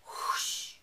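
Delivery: a long breathy whoosh; voiced breath whoosh effect; breathy whoosh sound. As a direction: blow out the whoosh.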